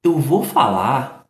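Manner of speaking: ordinary talk, nothing marked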